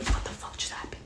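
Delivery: breathless stunned whisper; shocked whisper. Retold or imitated. Reader stunned by the sudden killing, whispering in shock. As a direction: hush it like you can't believe it.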